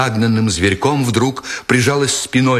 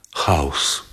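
much softer, lower, barely voiced; weak gulp; 8 semitones lower, 5 dB quieter than usual. A narrator's reading. Terrified German girl answering the lieutenant, weak swallowed word. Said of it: "слабым глотательным звуком".

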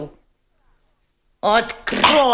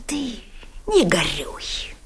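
whisper; 3 semitones lower, 7 dB quieter than usual. Acted. Consoling the boy, whispered.

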